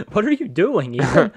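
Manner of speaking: loud, high scolding; exasperated yell. Real, not acted. Watcher to Ethan playing badly, exasperated yell. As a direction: yell at him in disbelief. Scolding the player after repeated asking.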